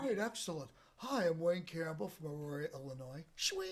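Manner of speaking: slacker TV-host impression; Wayne Campbell. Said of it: "he was doing Wayne from Wayne's World".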